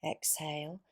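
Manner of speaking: ordinary talk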